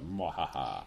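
low, soft evil cackle; hammed-up villain laugh; mock evil laugh. Villain laugh after quote.

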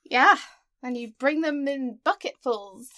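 loud "yeah", then drawn-out mock praise; sarcastic. Real, not acted. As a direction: lay the sarcasm on slowly. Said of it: "That was incredibly sarcastic".

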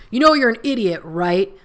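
retold insult from the border guard, loud and high; mock angry guard. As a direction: sneer it like a bullying guard.

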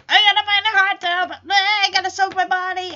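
high, fast, loud spoiled whine; mock whining. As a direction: whine it like a hammed-up diva. Mocking Margot's fussy demand.

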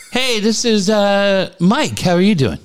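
high-pitched chirpy caller greeting; phone caller. Retold or imitated. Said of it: "this guy goes".

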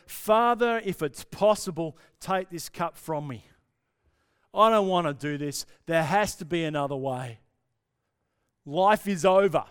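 loud, pleading cry to the Father; crying out in prayer. Retold or imitated. Jesus prays to his Father in the garden, crying out. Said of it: "he weeps and he cries out to his Father".

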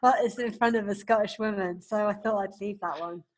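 talking through laughter; laughing while explaining. Real, not acted. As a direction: giggle through the words. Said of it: "Thank you".

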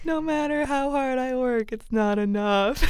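hammed-up weepy lament, on the edge of laughing; mock tearful. Wife explains her crying to husband, mock tearful. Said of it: "most of the arc of weeping".

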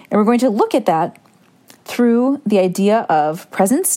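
ordinary talk

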